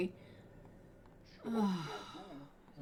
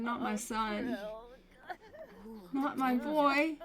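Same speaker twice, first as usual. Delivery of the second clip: higher, drawn-out wail; mock grieving mother; 4 semitones higher, slower than usual. Mother mourning her son, mock wailing. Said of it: "She's like".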